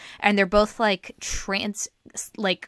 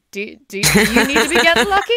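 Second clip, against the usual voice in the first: laughing; 3 semitones higher, 10 dB louder, faster than usual. Acted. Mock reply to the song.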